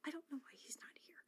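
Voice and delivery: whisper. Real, not acted. Whispering aside.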